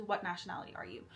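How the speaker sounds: ordinary talk, nothing marked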